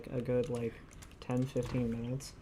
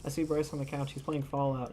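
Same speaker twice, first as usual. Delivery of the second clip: ordinary delivery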